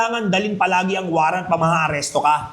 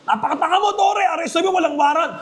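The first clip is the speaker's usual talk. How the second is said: much higher mock shout of an angry netizen; angry rant; 10 semitones higher, faster than usual. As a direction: rant like a furious citizen. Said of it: "galit na galit". (galit na sigaw ng taong nagrereklamo)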